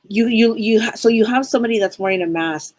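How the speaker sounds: ordinary delivery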